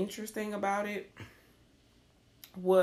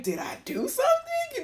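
high baffled. Retold or imitated. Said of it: "I'm like".

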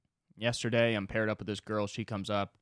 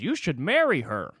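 lightly voiced spry old man, louder; over-energetic old man; 3 semitones higher, 6 dB louder than usual. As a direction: pipe up like a meddling old-timer. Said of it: "he's way too energetic".

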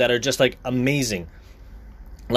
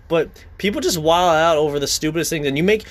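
ordinary talk

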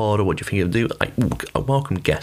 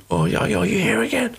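mock complaint; 13 semitones higher than usual. Repeat guest confronts housekeeper, retold.

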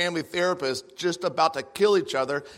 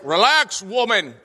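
higher, louder condescending husband; mock husband; 6 semitones higher, 7 dB louder than usual. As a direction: talk down to her like a smug husband. Husband's condescending retort mocked.

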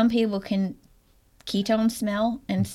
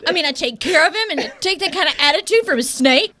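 loud, hammed-up indignation; mock indignant; 9 semitones higher, 8 dB louder than usual. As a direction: play the offended owner. Mock indignant pet owner.

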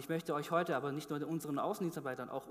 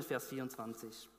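usual speaking voice

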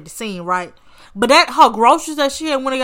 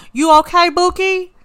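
high, louder questioning call; high-pitched quote; 8 semitones higher, 6 dB louder than usual. Retold or imitated. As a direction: imitate a caller checking in, pitched way up. Voicing sister-in-law's phone call quote.

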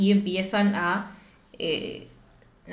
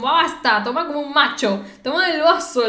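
stern mother; 5 semitones higher, 4 dB louder than usual. Retold or imitated. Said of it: "le dice".

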